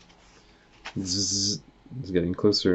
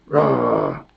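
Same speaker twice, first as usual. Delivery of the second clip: much lower, loud growling roar; deep bear roar; 11 semitones lower, 5 dB louder than usual. Acted. Narrator voices bear.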